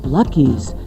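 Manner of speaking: usual speaking voice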